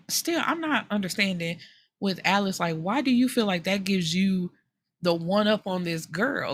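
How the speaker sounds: ordinary delivery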